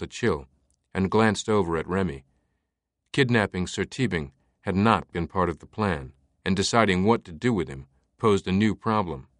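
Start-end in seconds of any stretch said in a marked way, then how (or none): none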